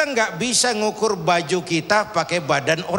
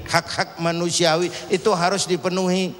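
normal talking voice

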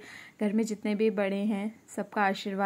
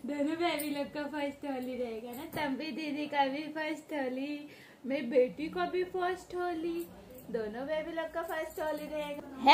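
higher and softer, gentle cooing; 6 semitones higher, 5 dB quieter than usual. Real, not acted. Mother softly cooing to her baby about his first Holi.

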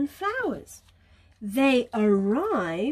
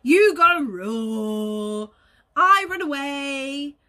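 very slow, careful explanation; slow explaining; 5 dB louder, much slower than usual. Acted. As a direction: spell it out slowly. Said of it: "trying to be very helpful".

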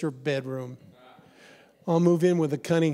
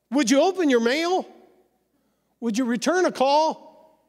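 much higher, smug know-it-all voice; hammed-up self-assured adviser, much higher; mock know-it-all; 9 semitones higher, 4 dB louder than usual. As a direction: play the pushy advice-giver. Mocking know-it-all giving God advice.

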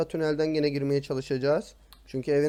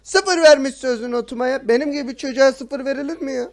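indignant complaint; 8 semitones higher, 8 dB louder than usual. Real, not acted. Complaining teacher gave zero.

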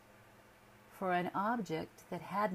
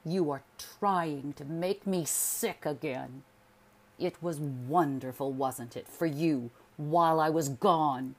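louder and louder, ending in a shout; agitated accusation; 9 dB louder than usual. A narrator's reading. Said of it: "his breath was agitated".

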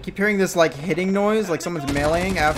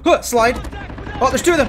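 excited callout; 9 semitones higher, 6 dB louder than usual. Real, not acted. Surprised by enemies.

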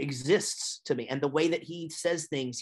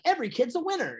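much higher, bright and eager; super excited; 13 semitones higher, faster than usual. Retold or imitated. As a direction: pitch it up like a thrilled mascot. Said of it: "like, super excited".

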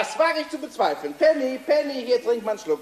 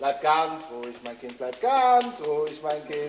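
lower, slow imitation of the uncle's comforting; mimicking the uncle to calm the girl; mock soothing uncle; 8 semitones lower, slower than usual. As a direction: copy the uncle's soothing tone, slowly and low.

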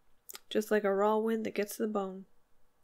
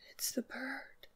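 very soft, higher whisper; shocked whisper; 4 semitones higher, 11 dB quieter, slower than usual. A narrator's reading. Woman sees the dead bird in the silk, shocked whisper. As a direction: whisper the discovery, barely voiced.

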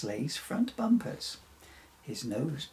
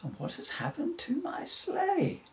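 indignant question, pitched higher; piqued Santa. A narrator's reading. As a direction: let Santa sound put out and higher. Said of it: "said Santa, rather piqued".

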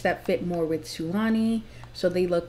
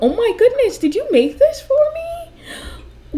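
gushing wife voice; 14 semitones higher, 5 dB louder than usual. Pretend. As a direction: squeal it like she's overcome with joy. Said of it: "she's gonna be like".

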